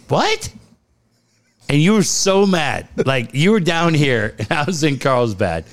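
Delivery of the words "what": squeaky high disbelief; can't believe what you heard; incredulous. Retold or imitated. Retold disbelief.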